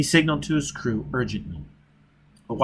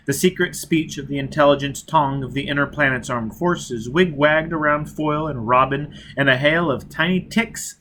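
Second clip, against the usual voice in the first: usual speaking voice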